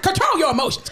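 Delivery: stern scolding. Retold or imitated. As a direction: scold like a strict nurse. Stern nurse practitioner scolding.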